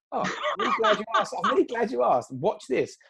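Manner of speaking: high, fast, giggly delight; telling the nurse, gleefully laughing. Retold or imitated. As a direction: show off the video, laughing. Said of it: "And I'm like".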